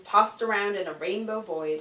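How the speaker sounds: plain reading voice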